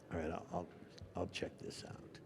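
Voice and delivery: soft, nearly voiceless reply; barely audible. Acted. Actor answering his angry co-star in a near-whisper. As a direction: murmur it, eyes down.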